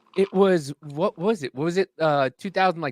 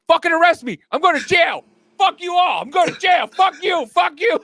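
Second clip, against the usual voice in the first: high, loud hammed-up yelling; pitched-up ranting shout; mock furious shout; 11 semitones higher, 4 dB louder than usual. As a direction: scream it like he dares them to arrest him. Angry man shouting at cops.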